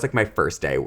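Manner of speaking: normal talking voice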